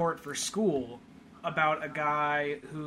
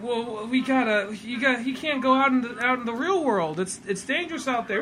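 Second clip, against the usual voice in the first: put-on worried kid warning; 7 semitones higher, 5 dB louder than usual. Kid warns Sonic about the world, high worried voice. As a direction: warn your new friend anxiously. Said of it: "he's like".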